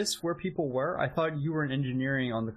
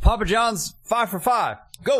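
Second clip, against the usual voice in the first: quarterback calling cadence; 8 semitones higher, 4 dB louder than usual. Pretend. Mimics quarterback snap call.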